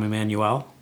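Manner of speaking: usual speaking voice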